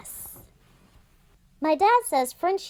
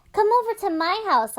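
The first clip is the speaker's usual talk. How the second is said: excited calling; 9 semitones higher, 3 dB louder, faster than usual. Acted. Calling out to friends.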